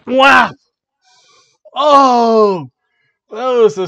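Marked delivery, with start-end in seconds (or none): 0.0-0.5 loud, high spoken smack; sharp imitation of a slap; slap noise. Wrestler slaps her partner, loud mimicked whack. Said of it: "And she just went". 1.8-2.7 loud, high drawn-out "oh"; shocked cry. Acted. Fan reacting to the slap, loud shocked cry.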